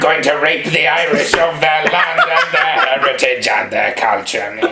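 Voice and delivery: villain voice. Acted. Acted villain quote, mock.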